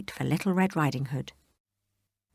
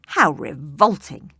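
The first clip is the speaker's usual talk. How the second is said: disgusted cry; 6 dB louder, slower than usual. Acted. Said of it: "cried the wolf".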